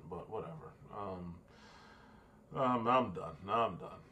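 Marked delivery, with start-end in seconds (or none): none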